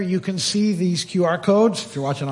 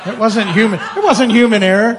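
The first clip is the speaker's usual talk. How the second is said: laughing; 3 semitones higher, 7 dB louder than usual. Real, not acted. Joking about the glitch.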